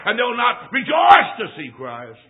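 loud ringing declaration; preaching shout. Real, not acted. Preacher warning the congregation, shouting. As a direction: cry it out to the church.

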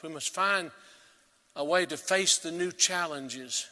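usual speaking voice

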